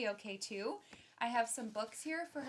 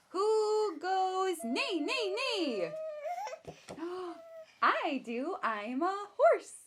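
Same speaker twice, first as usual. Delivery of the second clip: slow, higher sing-song question and answer; reading to a baby; 8 semitones higher, 4 dB louder, slower than usual. Acted. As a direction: draw the baby in with each animal. Reading picture book to baby.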